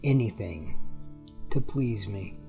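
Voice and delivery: ordinary talk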